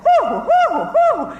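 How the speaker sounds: high, loud, repeated calling; back and forth hoo calls. Retold or imitated. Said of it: "So you get".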